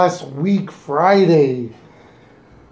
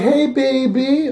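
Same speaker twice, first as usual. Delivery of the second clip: imitating a flirty British caller, slow and higher; 6 semitones higher, slower than usual. Host retells the flirty private caller: slow, higher mock come-on. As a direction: drawl it out like a teasing late-night caller. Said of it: "she's like".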